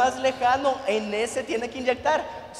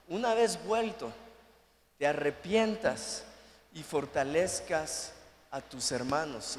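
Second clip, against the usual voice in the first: lower, softer and fading; tender scripture quote; 7 semitones lower, 5 dB quieter than usual. Acted. Pastor reading Jesus to Peter, soft and tender. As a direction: read the words of Jesus gently. Repeating verse, soft and slow.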